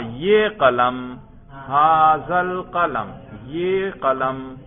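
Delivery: drawn-out phrase and translation; slow, projected classroom repetition; slow emphatic modelling. Shown as a demonstration. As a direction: stretch each word so the difference sinks in. Teacher contrasts phrase and its translation.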